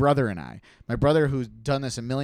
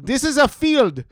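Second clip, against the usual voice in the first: high, loud, slow mock driver; imitating the driver; 12 semitones higher, 8 dB louder than usual. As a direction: ham up the driver's line. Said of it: "the guy kept saying".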